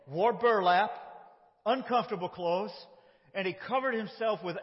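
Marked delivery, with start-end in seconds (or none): none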